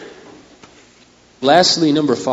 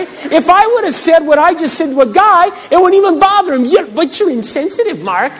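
loud, high, indignant protest; preaching at full force; 14 semitones higher, 6 dB louder than usual. Acted. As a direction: climb higher and louder, insisting on innocence.

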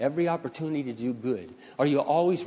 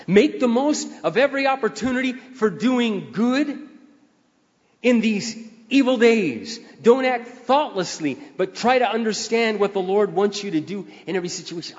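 preaching at full force; 6 semitones higher, 5 dB louder than usual. Acted. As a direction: lift every word up to the congregation.

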